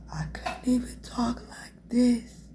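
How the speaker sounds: voiceless whisper, almost no sound; barely-there whisper. Shown as a demonstration. Showing viewers how she couldn't talk after the coma, weak whisper. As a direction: whisper as if the voice is nearly gone. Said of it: "That was loud".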